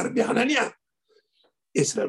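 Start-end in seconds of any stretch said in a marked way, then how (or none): 0.0-0.7 a mild mock yell of the name; imitated shout. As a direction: mimic the father calling out. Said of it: "como mi papá grita".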